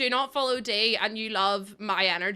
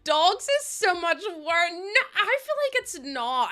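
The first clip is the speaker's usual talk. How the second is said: laughing; 9 semitones higher, 5 dB louder, slower than usual. Real, not acted. Laughing fit over toes joke.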